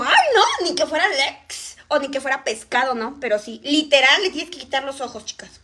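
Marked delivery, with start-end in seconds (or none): 0.0-1.8 squealed retort. Retold or imitated. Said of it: "me decía yo".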